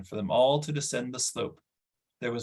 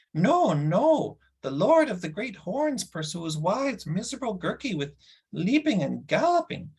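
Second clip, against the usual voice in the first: much higher, sing-song creature whine; whining creature Gurgi; 10 semitones higher, 3 dB louder than usual. Shown as a demonstration. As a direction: redo the line as the frightened little beast. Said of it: "No, sorry".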